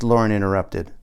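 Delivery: ordinary delivery, nothing marked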